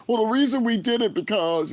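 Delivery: high, whiny mock excuse-maker. Vlogger mocking people who always have an excuse, high put-on whine. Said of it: "they always got an excuse".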